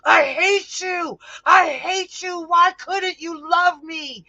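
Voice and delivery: high, loud, sobbing screams of hatred; screaming through tears. Acted. Ganymede screaming at his father who sold him, high and loud through tears. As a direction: hurl each line at him through tears.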